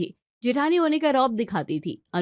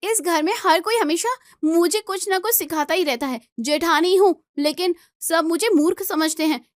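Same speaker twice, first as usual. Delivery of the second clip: higher and louder, wounded anger; hurt outburst; 6 semitones higher, 4 dB louder than usual. Acted. Elder bride snaps at her sister-in-law, hurt and loud. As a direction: lash out, feeling belittled.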